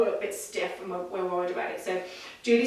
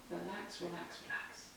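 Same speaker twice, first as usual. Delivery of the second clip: soft self-coaching; 4 semitones lower, 18 dB quieter than usual. Real, not acted. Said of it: "tell myself to relax".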